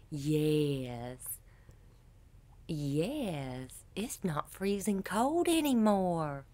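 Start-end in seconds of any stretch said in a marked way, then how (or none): none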